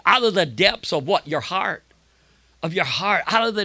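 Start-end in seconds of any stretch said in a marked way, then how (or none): none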